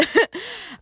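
high little laugh, falling away; brief laugh escaping after a remark; short laugh. Real, not acted. Woman admitting she just talked about that, short high laugh.

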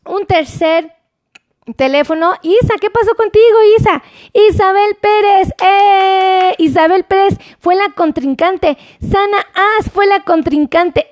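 high-pitched, playful announcing; excited contest host. Real, not acted. Host announces Isa's challenger to viewers, excited and high.

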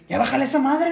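high, loud parental telling-off; mock scolding. Parents scold a gamer, high and loud mock scolding. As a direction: imitate a parent yelling to turn it down. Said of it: "a mí que me regañan".

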